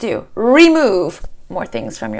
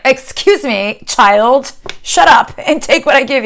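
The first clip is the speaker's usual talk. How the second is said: put-on louder, higher, scolding a child; stern God scolding; 5 semitones higher, 7 dB louder, slower than usual. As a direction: put the child in her place, slowly at first. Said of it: "And he's like".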